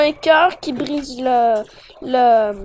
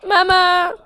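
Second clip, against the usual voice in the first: frightened call out, pitched up; scared cry for mom; 5 semitones higher, 5 dB louder than usual. Pretend. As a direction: yelp for your mother in fright.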